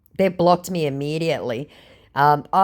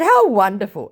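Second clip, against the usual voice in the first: sarcastic; 6 semitones higher, 5 dB louder than usual. Retold or imitated. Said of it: "I said".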